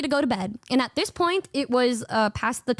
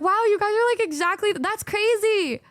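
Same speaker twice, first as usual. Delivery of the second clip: amazed roommates; 7 semitones higher than usual. Retold or imitated. Roommates comparing Sho to Numi, amazed and excited. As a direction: gush in disbelief. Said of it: "they're like".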